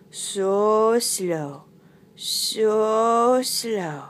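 long, stretched "So slow"; drawn-out slowness. Acted. Narrator showing loris's crawl, slow drawn-out words. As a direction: drag each vowel of "So slow" right out.

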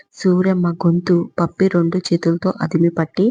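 ordinary delivery, nothing marked

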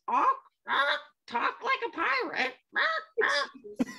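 squawking parrot, high. Acted. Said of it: "It says".

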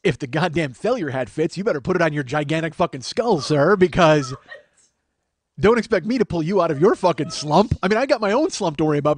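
loud, worked-up comeback; comic indignant rant. Acted. Comic tells off his self-pitying friend, indignant rant. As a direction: tear into him with exasperated relish.

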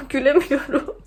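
laughing through mouth pain. Real, not acted. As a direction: laugh that hurts. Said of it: "An canım acıyor".

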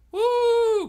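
sudden high, loud whoop; excited woo. Real, not acted. Streamer falling toward Earth in the game, excited whoop. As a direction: whoop as the rocket drops. Rocket engine fails, falling.

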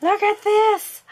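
breathless high squeal of joy; thrilled gasp. Real, not acted. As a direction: gush over the gift. Gasps over a gift.

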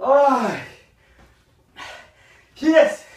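loud, high cry of relief; triumphant cry. Real, not acted. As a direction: celebrate finishing the set. Finishing set, relief cry.

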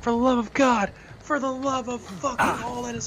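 loud, high pleading cry; desperate shout. Real, not acted. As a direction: beg the heavens at full voice. Panicked outburst in horror game.

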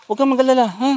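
usual speaking voice

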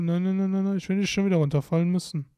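normal talking voice

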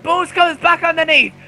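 rising excited commentary. Real, not acted. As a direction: call the overtake, thrilled. Climactic overtake in race commentary.

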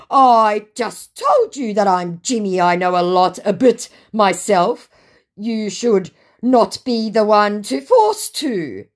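slow, loud, stilted imitation; mocked indignant scammer. Scammer insisting he is Jimmy, mock indignant. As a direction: lay out each word in mock indignation.

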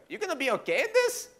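high-pitched, laughing disbelief; mock incredulous question. Dad questioning son at the tiny plane, incredulous. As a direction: ask it squeaky with doubt.

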